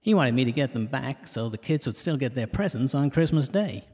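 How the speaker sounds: ordinary delivery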